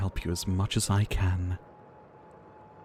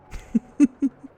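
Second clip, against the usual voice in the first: light laugh; 7 dB louder than usual. Acted. Amused at traveller's state.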